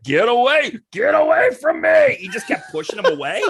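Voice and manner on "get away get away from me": loud, higher panicked yell; a tough guy spooked by an armless attacker, shouting; freaked-out shout. Retold or imitated. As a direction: push him off in a panic. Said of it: "So he's like".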